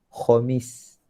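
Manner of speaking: Khamis drawn out, throaty kh; Khamis, long rasped kh; slow Arabic Khamis. Shown as a demonstration. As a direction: slowly model Khamis with a rasped kh. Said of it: "Sepatutnya Khamis".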